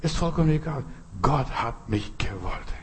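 normal talking voice

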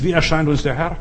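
normal talking voice

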